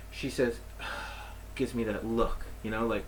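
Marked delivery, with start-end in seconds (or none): 0.6-1.5 soft unvoiced sigh of annoyance; exasperated sigh. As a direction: let out a tired breath. Clerk's exasperated reaction.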